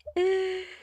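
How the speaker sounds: a quick high burst of laughter; short high laugh. Real, not acted. Streamer laughing at his own slow building, high and brief.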